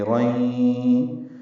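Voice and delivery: رئی sung very slowly with the sound drawn up into the nose; nasal ghunna demo. Shown as a demonstration. Teacher demonstrates nasalised merging on رئی. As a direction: hold رئی long through the nose. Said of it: "آواز ناک کے بانسے میں لے جائیں گے".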